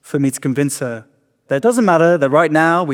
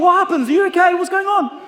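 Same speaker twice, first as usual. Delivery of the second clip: much higher, fussing stranger voice; concerned stranger. Retold or imitated. A stranger asking the crying comedian, higher and fussy. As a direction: fret over him like a worried passer-by.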